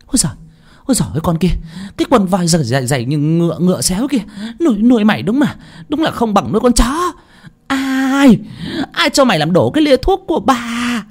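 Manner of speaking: enraged mistress, high-pitched; hissed fury. A narrator's reading. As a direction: screech at the maid, building as you go. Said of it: "mà rít lên".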